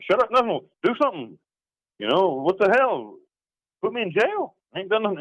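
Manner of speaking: retold indignant outburst, pitched very high; angry. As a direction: argue back at your lawyer, high-pitched and outraged. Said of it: "I got angry".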